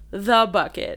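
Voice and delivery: "The" leaned on in "The Bucket"; "The Bucket" with the article pointed up; stress on The. Real, not acted. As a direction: give "The" weight in "The Bucket". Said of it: "The was the really important part".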